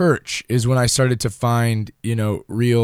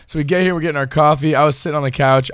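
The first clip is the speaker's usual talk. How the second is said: ordinary talk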